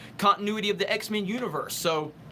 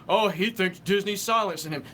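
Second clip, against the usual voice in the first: mocking whiny critic. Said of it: "they're going".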